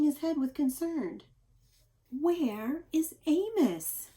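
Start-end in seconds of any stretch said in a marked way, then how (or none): 2.1-4.1 slow, higher, puzzled wondering; wondering aloud. Acted. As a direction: stretch it out, puzzled. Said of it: "the animals wondered".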